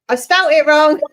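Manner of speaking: loud, amused self-correction; exclaiming at own mistake. Real, not acted. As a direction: blurt it out loud and high. Realising own spelling mistake, exclaims.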